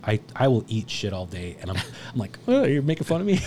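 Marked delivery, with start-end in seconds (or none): 2.5-3.4 high, mock-innocent question, half laughing; naive. Storyteller playing his naive self to the gentleman, mock-innocent. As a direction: play it wide-eyed and naive, pitched up. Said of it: "I'm pretty naive".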